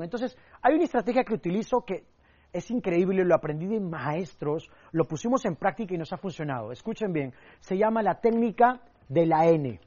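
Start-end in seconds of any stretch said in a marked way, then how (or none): none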